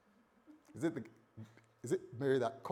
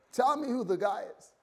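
higher, pressing demand; hurt demand; 9 semitones higher, 4 dB louder than usual. Acted. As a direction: insist on a name, stung. Heartbroken Joseph demanding answers.

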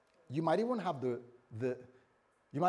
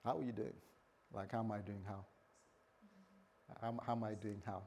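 soft, tender; 5 semitones lower, 11 dB quieter than usual. Retold or imitated. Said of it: "and say".

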